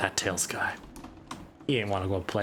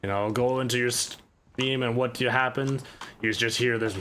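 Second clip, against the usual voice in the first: ordinary talk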